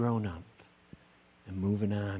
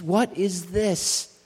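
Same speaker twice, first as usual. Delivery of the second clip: slow and loud, higher, a long drawn-out WHAT; booming question; 6 semitones higher, 5 dB louder, much slower than usual. Acted. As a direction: call the question out to the whole room. Rising refrain of the sermon.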